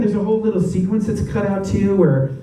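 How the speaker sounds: ordinary talk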